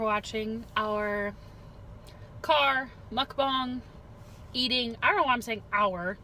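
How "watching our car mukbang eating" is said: drawn out. Real, not acted. Slow announcing sign-off.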